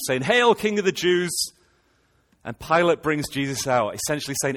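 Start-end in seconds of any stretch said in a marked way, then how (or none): 0.2-1.3 high, loud sneering hail; mocking hail. Soldiers jeering at Jesus, loud mock hail. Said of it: "And they're mocking him".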